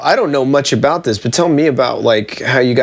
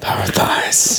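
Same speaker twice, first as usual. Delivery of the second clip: whispered; 3 dB quieter, slower than usual. Real, not acted. Echoing a funny phrase.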